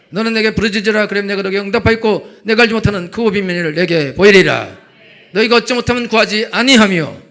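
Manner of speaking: loud fervent sermon delivery; full-force preaching. Acted. Pastor urges the congregation to call on God, loud and fervent. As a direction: preach it hard to the back row.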